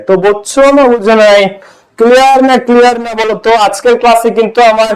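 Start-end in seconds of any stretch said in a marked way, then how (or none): none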